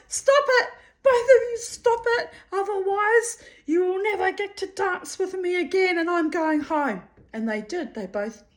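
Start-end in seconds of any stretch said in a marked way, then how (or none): 0.1-7.0 high-pitched, exasperated mock telling-off; shrill-pitched comic ultimatum, easing down towards the end; mock scolding. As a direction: act out scolding two squabbling friends, voice pitched right up. Telling off two fighting friends.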